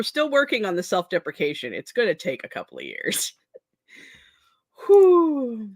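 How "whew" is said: laughing whew. Real, not acted. Laughing exhale after self-deprecation.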